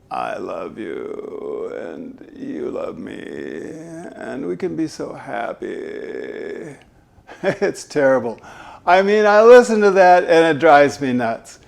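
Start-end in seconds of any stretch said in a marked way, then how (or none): 0.1-6.8 slow, low, soft creaky lovey voice; Bachelor-style vocal fry. Retold or imitated. Coach mocking reality show couples with vocal fry. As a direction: coo it slowly with fried endings. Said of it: "The Bachelor and The Bachelorette".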